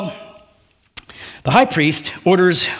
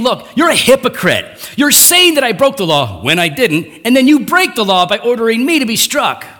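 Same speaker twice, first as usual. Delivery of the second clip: raised, heated voice calling out a hypocrite; mad rebuke; 7 semitones higher, 4 dB louder than usual. Retold or imitated. Paul rebuking the high priest, raised and heated. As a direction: lay into him, higher and louder. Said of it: "Paul's mad".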